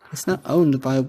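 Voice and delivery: usual speaking voice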